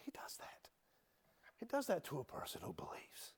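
hushed whispered testimony. Acted. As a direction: lean in and whisper it. Preacher dropping to a hush.

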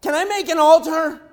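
high, loud eager question; eager Peter. Retold or imitated. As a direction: ask it bursting with zeal. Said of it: "And, and Peter's like".

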